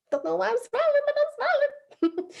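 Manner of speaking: high-pitched, silly happy self-talk; goofy mock cheer. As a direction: chirp it high through a big smile. Acts out smiling for no reason.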